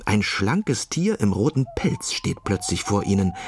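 ordinary delivery, nothing marked

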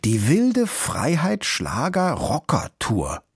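laboured, proud deciphering; reading out. A narrator's reading. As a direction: announce each poster word separately. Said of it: "fuhr Kluge Pfote fort".

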